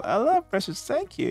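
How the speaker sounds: put-on high-pitched little-kid voice; child character voice. Child thanking the player for presents, high kid voice. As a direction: lift it high like a pleased child.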